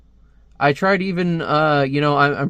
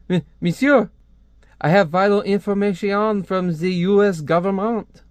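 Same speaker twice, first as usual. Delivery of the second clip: hammed-up French accent, pitched higher; 5 semitones higher than usual. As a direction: play an urgent French informant with a thick accent. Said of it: "I hate doing a French accent".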